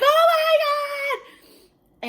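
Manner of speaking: long, drawn-out high wail; screaming. Retold or imitated. Pregnant client freaking out in salon, screaming. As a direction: stretch it into a retold scream. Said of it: "I was screaming".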